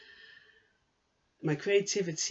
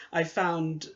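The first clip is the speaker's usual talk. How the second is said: ordinary talk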